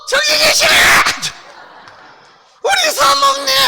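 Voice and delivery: high, cheeky puppet voice; ventriloquist puppet voice. Acted. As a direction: tease the crowd as a high little puppet. Puppet greets pastor's wife. (높고 장난스러운 인형 목소리)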